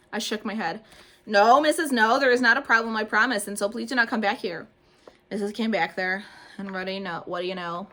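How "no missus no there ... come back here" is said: loud, hurried denial; panicked pleading. A narrator's reading. Junie pleading with her teacher to stay away, loud. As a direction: insist too hard nothing's wrong.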